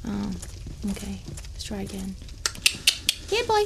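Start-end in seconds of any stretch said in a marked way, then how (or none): 3.3-3.6 calling a lost pet. Pretend. Calling the pig over.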